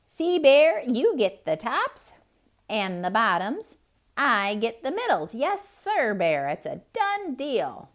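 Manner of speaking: high, cheery hare voice; sly Hare. Acted. Hare smugly explaining the deal to Bear, high and cheery. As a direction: sell the bad deal sweetly.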